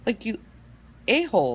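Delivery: high, loud, outraged retort; exasperated yell. Retold or imitated. Retelling road rage at tailgaters, mock outraged yell. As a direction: let the rude drivers have it in mock fury. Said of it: "I was just like".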